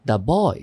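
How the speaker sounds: put-on the boy, "the" said as da; "the" as thuh. Shown as a demonstration. Teacher showing students "the" said as thuh. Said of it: "এখানে হচ্ছে দা".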